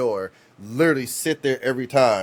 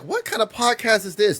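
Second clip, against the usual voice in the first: high, incredulous question; high mock surprise; 12 semitones higher, 4 dB louder than usual. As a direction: ask in a high disbelieving voice. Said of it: "Then I'm like".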